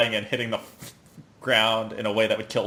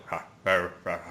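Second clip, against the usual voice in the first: low zombie grunting; 6 semitones lower, 3 dB quieter, much faster than usual. Retold or imitated.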